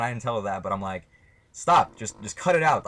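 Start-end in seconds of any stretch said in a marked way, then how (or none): 1.5-2.8 firm command, louder and higher. Retold or imitated. Telling a girl who pinched him to stop, very firm. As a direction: put your foot down, raised voice. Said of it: "I told her very firm".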